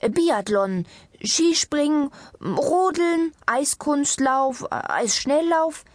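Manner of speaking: high-pitched, insistent list; eager listing. A narrator's reading. Doctor carrying on with winter sports, eager. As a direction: keep the ideas coming, stretching the last ones. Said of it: "Die Ärztin fuhr fort".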